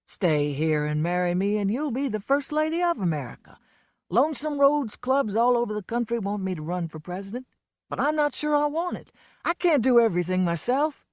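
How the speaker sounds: a lightly voiced boozy Southern showman; a hint of a drunk country star, fed up; lightly played drunk blowhard; Lonesome's voice. A narrator's reading.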